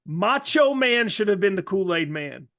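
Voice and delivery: ordinary delivery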